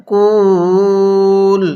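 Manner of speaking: কুল stretched very long and level; long held vowel. Shown as a demonstration. Teacher showing the stop at the verse end, long vowel. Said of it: "কিভাবে পড়ব".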